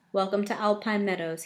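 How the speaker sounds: ordinary delivery, nothing marked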